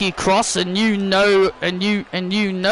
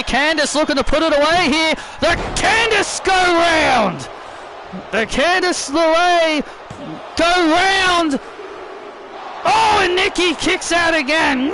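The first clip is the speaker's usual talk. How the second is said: excited play-by-play; 8 semitones higher than usual. Acted. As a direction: call the action like it's huge. Live wrestling play-by-play.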